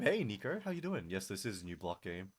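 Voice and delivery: ordinary talk, nothing marked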